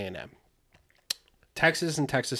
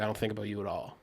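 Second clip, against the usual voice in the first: cold dismissal; 3 semitones lower, 9 dB quieter, faster than usual. Retold or imitated. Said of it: "he's like".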